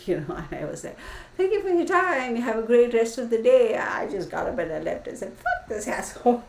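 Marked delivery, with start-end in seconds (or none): 1.4-3.8 high, loud put-on politeness; mock-polite sign-off. Acted cheery parting line to rude date. 5.3-6.5 high-pitched swearing through laughter; laughing curse. Retold or imitated. Laughing retort after leaving the date.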